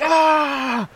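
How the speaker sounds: high, slow hammed-up yelp of pain; mock pained cry. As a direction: ham up a man burning his hands. Mock scalded soup eater.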